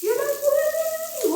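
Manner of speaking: slow high wail of dismay; shocked wail. Acted. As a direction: let the alarm stretch out slowly. Mother sees water flooding.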